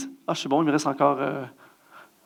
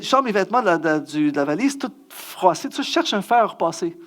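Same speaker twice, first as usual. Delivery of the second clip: ordinary delivery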